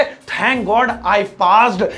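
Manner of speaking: very slow, measured English; slow model sentence. Shown as a demonstration. Teacher modelling what a relieved student says, very slow and measured. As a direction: space the words out for learners.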